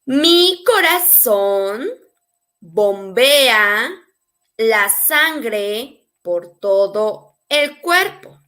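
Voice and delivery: slow, phrase by phrase; slow teaching model. Shown as a demonstration. Spanish teacher models the heart sentence for learners, slowly. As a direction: draw it out with pauses between phrases.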